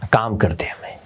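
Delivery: soft unvoiced whisper. Shown as a demonstration. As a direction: breathe the words out softly. Soothing aside whispered. (फुसफुसाकर)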